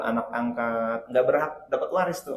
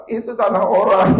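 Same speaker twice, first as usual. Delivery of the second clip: raised, higher-pitched comeback; loud retort; 7 semitones higher, 10 dB louder than usual. Acted. Father answering his puzzled child, loud retort. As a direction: answer back loudly and high.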